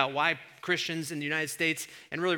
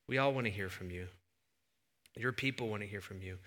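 softer, reverent prayer voice; 4 semitones lower, 4 dB quieter than usual. Real, not acted. Pastor praying over the congregation, hushed and sincere. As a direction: pray quietly and sincerely.

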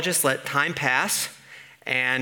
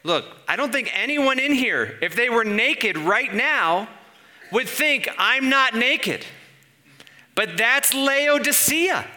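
high, slow, emphatic exclamation; incredulous preaching; 9 semitones higher, 4 dB louder than usual. Real, not acted. Preacher telling listeners that is Laodicea, high-pitched and incredulous. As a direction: pitch it high and hammer each phrase.

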